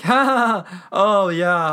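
loud high acted laugh into words; imitated hearty laugh; mock laughter. Acting out Bernard's laughing reaction.

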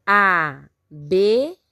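"Abelha" slowly split into a, be, lha; syllable by syllable. Shown as a demonstration. Teacher counting with the class, "Abelha" syllable by syllable. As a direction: stretch "Abelha" into three separate syllables. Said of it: "Quantas sílabas?".

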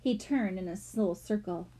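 ordinary delivery

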